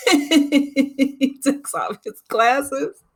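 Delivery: drawn out through giggles; slowly, cracking up at the memory; slow, laughing. Real, not acted. Retelling the glasses scene to viewers, laughing slowly.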